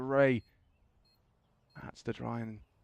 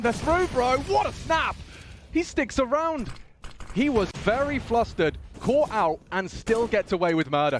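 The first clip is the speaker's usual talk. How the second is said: excited shout; 9 semitones higher than usual. Real, not acted. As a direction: yell it like a big play. Hype caster call.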